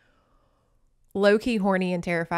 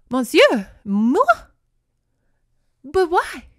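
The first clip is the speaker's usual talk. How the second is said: louder, higher mock-dramatic maiden; hammed-up shock; 7 semitones higher, 7 dB louder, slower than usual. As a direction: overplay a soap heroine's alarm. Telenovela maiden reacting, shocked.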